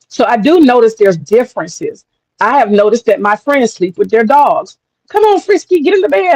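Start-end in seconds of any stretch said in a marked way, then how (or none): 5.1-6.4 high-pitched, coaxing call to a pet; calling the dog. Retold or imitated. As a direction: imitate a friend calling the dog up. Friends calling dog into bed.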